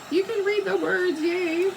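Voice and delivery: high, loud happy squeal; delighted cheer. Real, not acted. Showing viewers the finished lettering, high happy cheer. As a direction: cheer that the words came out readable.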